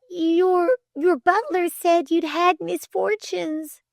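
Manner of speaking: put-on high, hesitant girl's voice; murmured. A narrator's reading. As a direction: venture it timidly in a high voice. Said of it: "murmured Flame".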